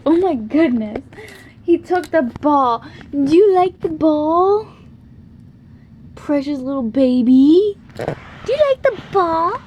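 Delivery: high, loud puppy calling. Real, not acted. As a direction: call the puppy in sing-song. Playing with puppy.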